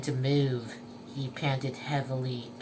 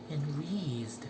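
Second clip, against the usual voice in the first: usual speaking voice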